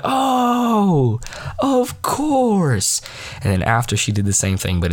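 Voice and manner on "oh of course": high, drawn-out mock oh; imitating a teacher's high-pitched reaction; exaggerated realization. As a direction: sing out a high, theatrical oh.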